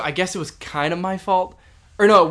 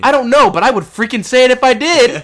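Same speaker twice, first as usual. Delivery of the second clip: emphatic rant; 3 semitones higher, 9 dB louder than usual. Real, not acted. Venting at the coworker she wants to hear this, loud rant. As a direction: hurl each word at him, loud and forceful. Mock tirade at absent coworker.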